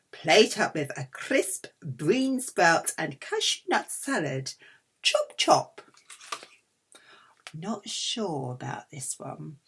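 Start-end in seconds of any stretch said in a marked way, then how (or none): 5.0-5.8 sing-song, much higher chop chop. Acted. Vlogger hurrying viewers along, sing-song high.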